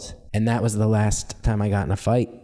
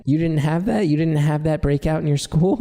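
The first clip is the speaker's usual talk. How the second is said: retold naive disbelief, pitched up; incredulous; 6 semitones higher than usual. Telling college friends hometown stories, incredulous. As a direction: ask as if everyone surely had this. Acts out his surprise at classmates.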